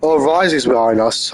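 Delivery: ordinary talk, nothing marked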